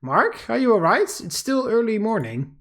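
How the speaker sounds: cheerful but sleepy. Acted. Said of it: "her cheerful but sleepy voice".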